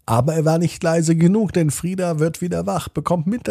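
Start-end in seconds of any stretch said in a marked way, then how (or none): none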